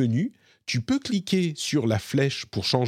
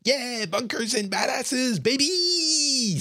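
put-on much higher, slow, hyped-up girl's voice cheering her game; Tiny Tina impression; 13 semitones higher, much slower than usual. Streamer mimicking Tiny Tina hyping Bunkers and Badasses, high hammed-up cheer. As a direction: gush it like a wild kid announcing her tabletop game. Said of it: "Elle est là".

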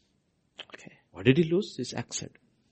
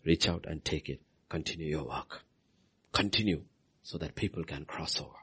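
soft closing appeal; 5 semitones lower, 9 dB quieter than usual. Acted. Soft closing appeal, whispered end.

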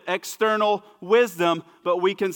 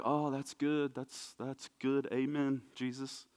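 much softer, offhand scribe's approval; mock casual amen; 3 semitones lower, 11 dB quieter, slower than usual. Scribe lightly approving Jesus, softer and offhand. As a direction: shrug it off, easy and soft. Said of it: "the scribe to be like".

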